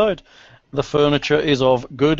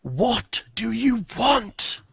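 dark lord; 9 semitones higher, slower than usual. Acted. Banshee repeats her demand.

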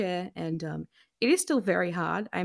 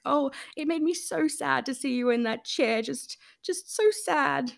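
mocking pity; 7 semitones higher than usual. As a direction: parody a syrupy sympathiser. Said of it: "someone messaged me saying".

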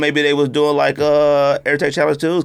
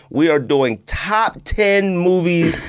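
slow announcement; 6 semitones higher, much slower than usual. Real, not acted. Podcast announcement to listeners.

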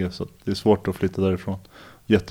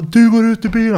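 higher, louder command; imitating a cop's shout; 12 semitones higher, 6 dB louder than usual. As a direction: bark it like the cop who thinks he's lying. Cop insists again.

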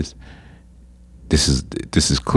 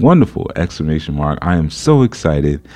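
gushing, extra gullible. Acted. Woman repeats her bait message to the scammer, gushing gullibly. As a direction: lay on the naive excitement, mock-sincere. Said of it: "come off as extra gullible".

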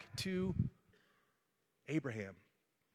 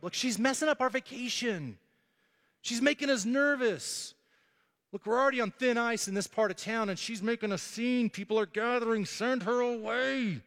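higher, fussy whining to Jesus; complaining disciples; 6 semitones higher than usual. Acted. Disciples urging Jesus to send the woman away, mild whiny plea. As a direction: needle the boss to get rid of her.

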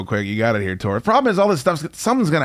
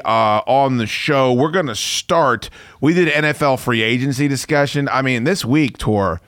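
ordinary talk

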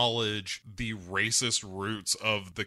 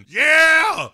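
high, very loud drawn-out yell; 14 dB louder than usual. Retold or imitated. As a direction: holler it out of a car window. Imitated redneck yelling from car window.

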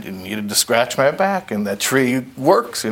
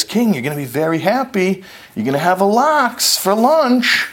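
chef announcing; 9 semitones higher than usual. Acted. Chef announces lunch to king.

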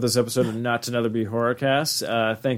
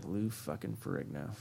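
mock grudge; 4 semitones lower than usual. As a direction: curse the name, then crack up. Mock outrage at monster maker.

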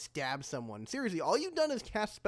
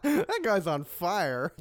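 ordinary talk, nothing marked

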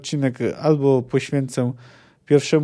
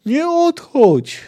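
high, loud, slow drawn-out cry; one last stretched plea; child's desperate cry; 13 semitones higher, 5 dB louder, much slower than usual. Acted. Ciri cries after Geralt once more, slow high loud plea.

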